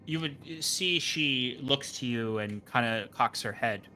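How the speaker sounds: ordinary talk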